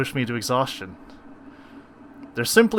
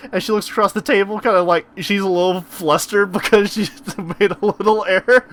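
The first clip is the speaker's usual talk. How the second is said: ordinary delivery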